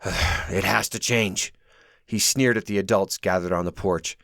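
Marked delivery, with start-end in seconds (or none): 0.0-1.5 resigned sigh. A narrator's reading. Sanders sighs, resigned.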